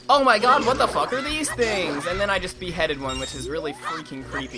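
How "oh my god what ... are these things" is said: much louder, higher panicked cry; mock terrified yell. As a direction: freak out like a spooked player. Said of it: "And then you're like".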